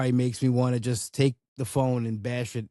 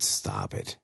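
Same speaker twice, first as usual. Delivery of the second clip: fried mutter; 6 dB quieter than usual. Retold or imitated. Exasperated aside under breath.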